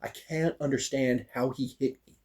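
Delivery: softly retold frustration; quiet, held-in annoyance; subdued bafflement. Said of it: "I'm mad. And I'm still".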